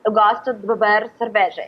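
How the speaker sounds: Portuguese in a North American accent, full of schwas. Shown as a demonstration. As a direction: imitate an American speaking Portuguese, vowels reduced. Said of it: "North American person would say".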